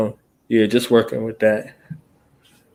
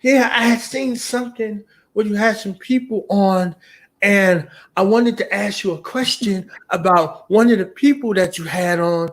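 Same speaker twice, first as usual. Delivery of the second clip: higher vague fan voice; imitating a fan; 6 semitones higher than usual. Host mimics fan who wants to ask about a guest, rambling. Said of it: "they be like".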